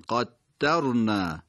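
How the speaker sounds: قدرنا very slow, with the doubled dal held; doubled consonant demo. Shown as a demonstration. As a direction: lean on the geminate in قدرنا. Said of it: "تشدید داره این کلمه".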